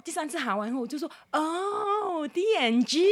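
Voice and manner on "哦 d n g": very slow, higher drawn-out "D N G"; dawning realization. Retold or imitated. Finally understanding her friend, slow drawn realization. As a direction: stretch it out as the penny drops. Finally getting the brand name.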